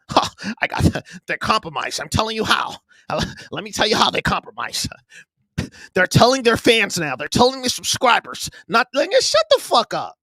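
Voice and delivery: ordinary talk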